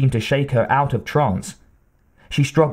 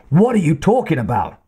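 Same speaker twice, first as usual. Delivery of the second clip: angry protest; 5 semitones higher than usual. A narrator's reading. Soldier protests angrily.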